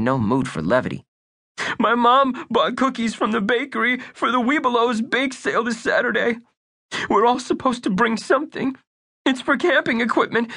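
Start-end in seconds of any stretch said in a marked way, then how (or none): 1.8-10.5 put-on much higher child voice; high, anxious young boy; earnest little boy. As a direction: play Bunky as a worried young kid. Narrator voices young boy Bunky.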